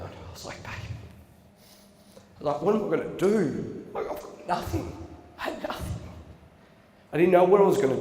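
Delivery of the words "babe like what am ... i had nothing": higher, half-whispered breaking plea to his partner. Retold or imitated. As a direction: let the voice crack in despair. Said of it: "I fucking broke down".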